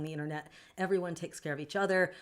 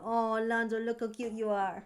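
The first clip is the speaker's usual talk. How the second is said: cooing at pet; 4 semitones higher than usual. Real, not acted. Streamer cooing at her pet on camera, higher baby talk.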